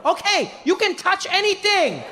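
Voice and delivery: bubbly tour guide call; overeager tour guide. Retold or imitated. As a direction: greet the group like a way-too-happy guide. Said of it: "Deng Basan inviting touching".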